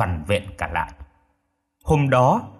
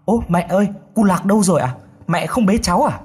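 higher-pitched, alarmed questioning; panicked asking; 7 semitones higher, faster than usual. A narrator's reading. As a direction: ask as if the baby has suddenly gone missing. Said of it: "thím hốt hoảng hỏi".

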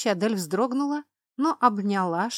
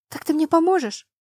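desperate pleading; 9 semitones higher, 6 dB louder than usual. Acted. Sharlin clings, begging.

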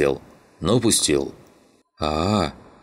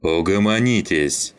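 raised, commanding call to order; mild yell; 3 semitones higher than usual. A narrator's reading. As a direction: rein in a brawling crew, half-yelling. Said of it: "заорал Саныч". (прикрикнув, начальственно)

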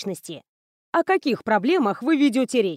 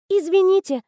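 put-on higher, timid girl's voice; the cheeky girl mumbles an apology; babbling apology; 7 semitones higher, 3 dB louder than usual. A narrator's reading. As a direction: bleat out a sorry like a guilty kid. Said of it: "пролепетала нахалка".

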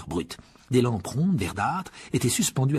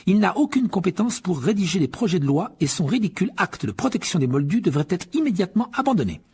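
higher, formal news-read voice; newspaper article read out; 7 semitones higher, 3 dB louder than usual. Acted. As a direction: read it like a pompous press report. Reading newspaper article aloud.

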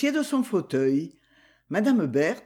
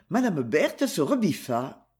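ordinary delivery

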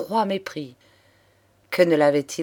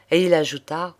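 ordinary delivery, nothing marked

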